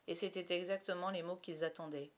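ordinary delivery

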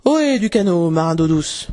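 a hint of a pirate captain hailing; lightly voiced captain's call across the water; Crochet imitation. A narrator's reading. Peter hailing the pirates' canoe as Crochet, pirate captain's hail. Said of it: "il se mit à imiter la voix de Crochet".

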